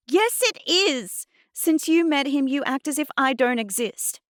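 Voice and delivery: high, loud, hurt outburst; furious accusation. A narrator's reading. Girl to Mum who ignores her, furious. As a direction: let the grievance burst out.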